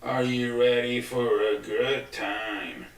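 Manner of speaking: slow, drawn-out ring-announcer call; hype announcer intro. Pretend. Host to viewers, slow drawn-out announcer hype. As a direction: hype the crowd like a show opener. Repeats the mock show intro.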